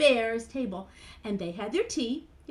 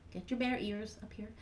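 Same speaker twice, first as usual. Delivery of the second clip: ordinary delivery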